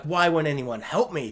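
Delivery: normal talking voice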